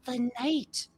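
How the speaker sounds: little ones trying to be stealthy; excited hushing kids. Acted. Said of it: "And they're like".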